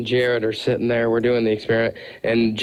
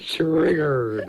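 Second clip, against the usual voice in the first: mock triggered; 3 semitones higher than usual. Heckling the overblown retold rant.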